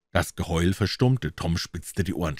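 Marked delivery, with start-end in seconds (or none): none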